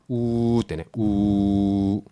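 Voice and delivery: うー held long through tightly puckered lips; rounded W sound. Shown as a demonstration. Teacher showing learners the W lips, long puckered sound. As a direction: stretch うー with a very pursed mouth. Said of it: "唇をすごくすぼめた音".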